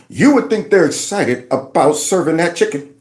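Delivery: usual speaking voice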